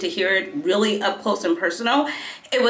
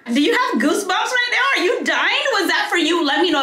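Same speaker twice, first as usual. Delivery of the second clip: high, laughing rush of glee; laughing excitedly; 10 semitones higher than usual. Real, not acted. Excited vlogger asking her viewers if they have goosebumps, laughing. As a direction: giggle it out, thrilled with the story.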